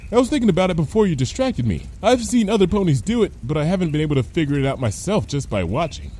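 quick, blithe character voice; Lyra, chatty. Acted. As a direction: chatter on unconcerned.

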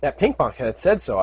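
ordinary delivery, nothing marked

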